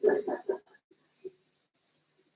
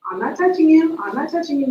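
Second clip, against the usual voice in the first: teasing brother; 5 semitones higher, 5 dB louder than usual. Retold or imitated. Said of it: "when Roger would do that".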